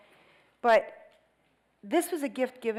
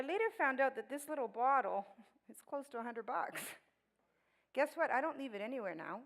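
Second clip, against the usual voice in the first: ordinary talk, nothing marked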